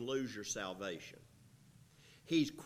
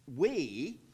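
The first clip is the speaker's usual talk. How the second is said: "we" hit louder and higher on its own; stress on we; 4 semitones higher, 8 dB louder than usual. Shown as a demonstration. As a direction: isolate "we" with a loud stress. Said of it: "and emphasize that".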